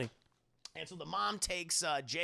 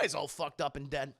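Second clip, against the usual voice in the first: normal talking voice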